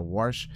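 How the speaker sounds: "wash" coming out as "warsh"; mispronounced with an r. Shown as a demonstration. Speaker giving an example of his habit, "wash" as "warsh". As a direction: put an r in the middle of "wash". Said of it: "I usually am speaking so fast".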